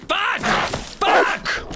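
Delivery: high, panicked yelps; panicked cursing. Real, not acted. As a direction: blurt out in sudden panic. Wolf attack, panic.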